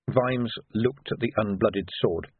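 ordinary delivery

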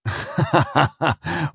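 dry staccato chuckle; captain's laugh. A narrator's reading. Captain amused at Flashman's cheek, staccato laugh. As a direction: laugh in a rapid run of ha-has. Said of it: "then he laughed".

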